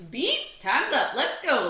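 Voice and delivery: high, loud call; announced. A narrator's reading. Larry announcing time is up to Stanley, high and loud. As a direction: announce it like a referee. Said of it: "Larry announced".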